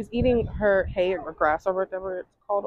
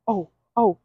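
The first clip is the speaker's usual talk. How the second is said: ordinary talk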